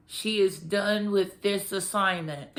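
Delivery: slow, laughing through the words; amused laugh. Real, not acted. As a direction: chuckle out the line. Laughing at the character's death.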